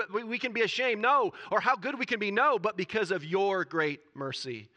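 loud, high, fervent sermon delivery; impassioned preaching. Real, not acted. Preacher declaring Daniel's prayer to the church, fervent. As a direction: drive each phrase home forcefully.

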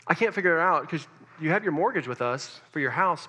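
normal talking voice

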